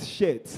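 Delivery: "shirt" said as "shet"; short e and no r in "shirt"; Nigerian pronunciation. Shown as a demonstration. Comedian shows Kenyans the Nigerian way, "shirt" as "shet". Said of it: "in Nigeria we call it".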